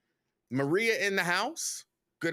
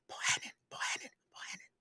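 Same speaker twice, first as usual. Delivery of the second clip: voiceless, fading gasp of a name; out of breath; 9 dB quieter, faster than usual. Real, not acted. Host greeting Roy Bohannon on air, really out of breath. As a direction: squeeze it out on no air, trailing away. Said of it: "I ran out of breath".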